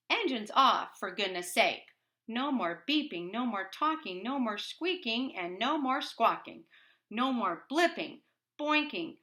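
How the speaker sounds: high, loud exasperated scolding; angry scolding. Acted. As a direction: scold the robots, fed up. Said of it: "he seems really angry".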